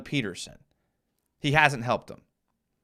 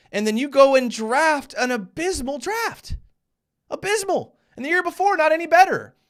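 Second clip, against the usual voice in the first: fired up; 11 semitones higher, 4 dB louder than usual. Real, not acted. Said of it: "I'm getting fired up here".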